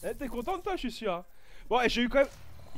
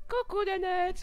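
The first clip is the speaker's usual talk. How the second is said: much higher cooing hello; dog-talk voice; 10 semitones higher, slower than usual. Real, not acted. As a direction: coo to the dog. Greeting his dog.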